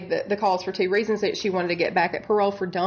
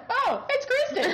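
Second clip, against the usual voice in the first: high, laughing mock realization; deadpan reveal played for laughs; mock investigators. Host imitating investigators unmasking the voice, high and laughing. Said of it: "were like".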